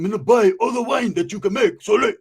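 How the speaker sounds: put-on Uso accent, punched out. Uso buying all the wine, Uso accent. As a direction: order eagerly in an imitated Uso accent. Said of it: "he had that little accent".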